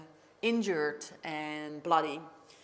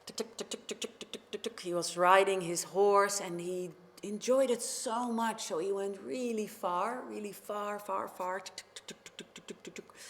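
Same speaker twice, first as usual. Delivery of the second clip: clicked hoofbeats. Acted. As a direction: click out a horse trotting. Imitating horse hooves.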